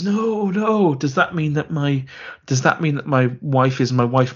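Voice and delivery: high wailed no, sliding down; hammy overacting. Hero doubting his family is his own, hammed-up overacting. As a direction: overdo the anguish like a bad actor. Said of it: "there's some overacting".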